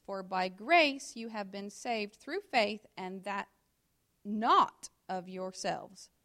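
loud, slow, deliberate scripture read. Acted. Preacher reading Ephesians to the congregation, slow and loud. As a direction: proclaim each phrase to the room.

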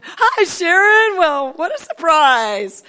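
loud, high, drawn-out hello; feigned surprise, big and slow; gushing surprised greeting. Retold or imitated. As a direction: belt out an over-delighted chance meeting. Acted surprise greeting to startle her.

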